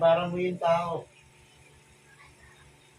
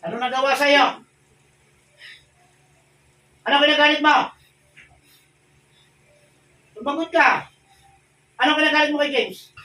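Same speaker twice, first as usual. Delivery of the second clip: loud, higher demands; shouted interrogation; 7 semitones higher, 9 dB louder than usual. Real, not acted. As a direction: force the spirit to answer. Exorcist interrogating a spirit.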